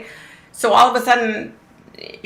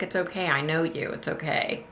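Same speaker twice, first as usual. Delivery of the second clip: very soft, lower, soothing words; hushed reassurance; 3 semitones lower, 10 dB quieter than usual. Retold or imitated. Manager reassures the shopper she knows, hushed and soothing. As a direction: quietly imitate a comforting manager.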